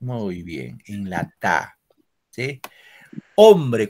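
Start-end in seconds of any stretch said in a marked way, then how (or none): 3.3-3.9 "Hombre" said loud, leaning on the first syllable "hom"; stressed model word. Shown as a demonstration. Teacher models the word for students, loud and stressed. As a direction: call out "Hombre" with weight on "hom". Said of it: "cuando digo hombre".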